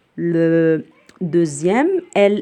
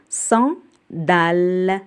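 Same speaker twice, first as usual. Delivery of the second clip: slow syllable demo; much slower than usual. Shown as a demonstration. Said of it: "repeats the word for children".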